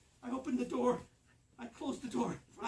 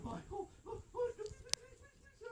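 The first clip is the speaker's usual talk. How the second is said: high, desperate pleading; high panicked please please; frightened begging. Retold or imitated. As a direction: beg for your life in a high voice. Fleeing gorilla, begging.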